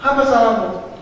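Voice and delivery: high, demanding dad voice; questioning father. Retold or imitated. Father asks child what went wrong, demanding.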